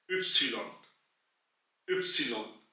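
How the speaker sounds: इप्सिलॉन said softly, German style; German letter name. Shown as a demonstration. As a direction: say इप्सिलॉन carefully. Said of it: "German में इसको कहते हैं". (इप्सिलॉन का German उच्चारण)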